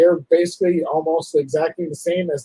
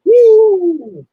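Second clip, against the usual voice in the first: excited whoop; 8 dB louder than usual. Real, not acted.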